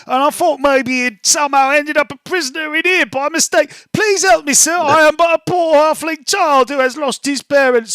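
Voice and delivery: put-on high, loud pleading little-boy voice; lost halfling child. Halfling rogue pleading with the guard, high pitched little-boy voice. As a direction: overplay a helpless lost child begging. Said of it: "very dramatically playing the part of the lost halfling child".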